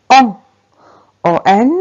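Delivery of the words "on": "on" as one rounded nasal vowel, no N heard; nasal vowel sound. Shown as a demonstration. Teacher introduces the next sound, "on" as a pure nasal vowel. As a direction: say "on" through the nose without closing on N. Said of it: "le son".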